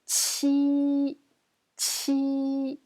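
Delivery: "Chi" as a high, held "chee"; pronunciation demo. Shown as a demonstration. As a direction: hold the vowel of "Chi" on a high, level pitch. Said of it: "comme ça".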